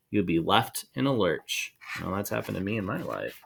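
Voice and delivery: plain reading voice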